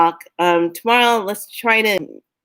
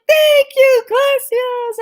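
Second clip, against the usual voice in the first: much higher and louder, chirpy tourist thanks; cheery thanks; 5 dB louder, much slower than usual. Retold or imitated. Arriving traveller thanking the airport greeter, high chirpy thanks. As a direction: squeak out a delighted thank you. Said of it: "And I'm like".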